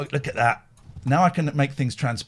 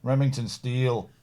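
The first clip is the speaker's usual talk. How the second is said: ordinary talk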